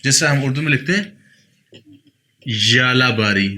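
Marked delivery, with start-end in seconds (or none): 2.5-3.5 slow "यालाबारी" opening on a strong zh; forceful zh onset. Shown as a demonstration. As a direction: press on the first sound of "यालाबारी", a buzzing zh. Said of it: "या पे थोड़ा सा जोर डाल के".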